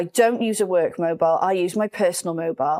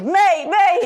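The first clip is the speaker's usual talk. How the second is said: British lad calling out; 9 semitones higher, 5 dB louder than usual. Retold or imitated. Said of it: "such a British thing to do".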